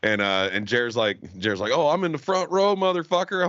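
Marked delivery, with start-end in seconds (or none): 1.7-3.5 higher, taunting boast; retelling a friend's bragging jab; mock gloating brag. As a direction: crow it like a playground taunt. Friend's boastful retort retold.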